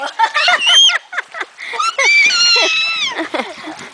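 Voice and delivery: high delighted shriek of laughter. Real, not acted. Mom shrieks with laughter at her brave baby. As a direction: let out a high squealing laugh.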